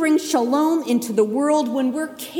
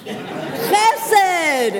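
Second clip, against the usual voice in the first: plain reading voice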